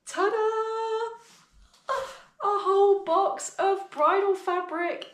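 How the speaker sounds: high, loud, near tears; big delighted ta-da; excited reveal. Real, not acted.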